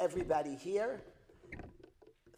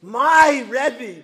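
proud boast; 4 semitones higher, 14 dB louder than usual. Retold or imitated. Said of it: "His students for years are telling the neighbors".

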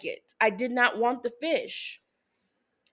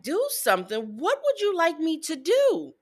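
incredulous mom; 6 semitones higher than usual. Retold or imitated. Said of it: "I'm like".

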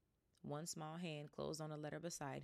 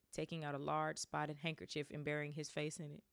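plain reading voice

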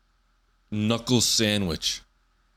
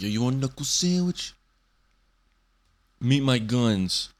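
put-on old-school tough guy; 4 semitones higher than usual. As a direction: brag like a gym bro. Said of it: "But the old insults".

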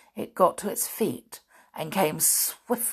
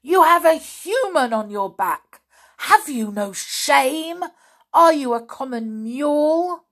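furious thundering; 7 semitones higher, 5 dB louder than usual. A narrator's reading. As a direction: rage at a fellow centaur. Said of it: "Bane thundered".

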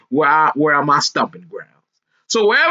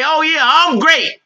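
confident boast; 9 semitones higher, 4 dB louder than usual. Retold or imitated.

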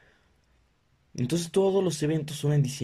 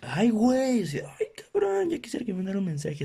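swooning squeal; 8 semitones higher than usual. Real, not acted. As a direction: melt at a flirty message. Reacting to flirty app message. (suspiro chillón de enamorada)

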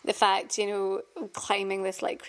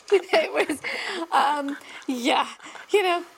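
laughing, higher and louder; 7 semitones higher, 4 dB louder, slower than usual. Real, not acted. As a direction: laugh through the words. Laughing after the story.

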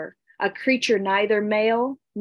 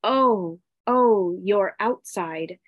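ordinary delivery, nothing marked

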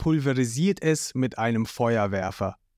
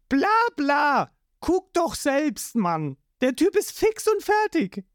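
mocking Patty; 12 semitones higher than usual. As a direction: wave off the question. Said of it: "Patty antwortet".

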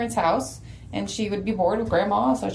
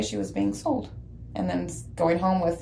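usual speaking voice

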